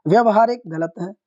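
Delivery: usual speaking voice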